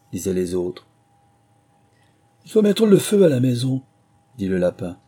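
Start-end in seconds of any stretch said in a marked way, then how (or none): 2.4-3.8 higher, louder command; rabbit's order. A narrator's reading. The rabbit ordering the house burned, loud and high. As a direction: call for the house to burn.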